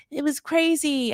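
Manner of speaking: put-on high-pitched, half-hearted French accent; attempted French accent. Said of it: "I can't do that French accent".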